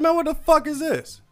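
high, loud outraged yell; hammed-up shrill outrage at a tiny paycheck; freaking out. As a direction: shriek it like he's been robbed. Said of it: "he lost his damn mind".

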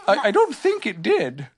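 high, hesitant bear voice; Pooh. A narrator's reading. Pooh questions the new animal, high voice. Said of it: "said Pooh".

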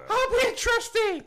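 high goofy puppet shout; Puppet George voice. Pretend. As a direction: squeal it like a sock puppet. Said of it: "in the Puppet George voice and just start yelling".

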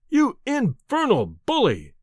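high, slow outraged cry. Acted. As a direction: hurl the insult out. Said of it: "I cried".

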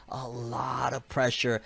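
normal talking voice

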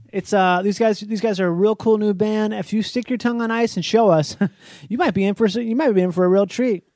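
radio DJ hype. Pretend. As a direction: sell it like a hyped radio host. Mock radio DJ patter.

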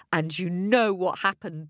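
ordinary delivery

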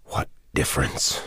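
pushed out on a ragged, unvoiced breath; a wounded man with barely air to speak; gasped. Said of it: "he gasped".

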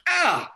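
high, loud "ah" of shock; acted-out cry of alarm; mock startled yelp. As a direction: yelp as if suddenly grabbed. Acts out shock at surprise kiss.

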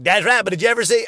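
high, loud, quick one-upping reply; imitating Vic's comeback. Vic schooling him on music, quick and loud. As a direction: cut in fast and loud, topping the other's story.

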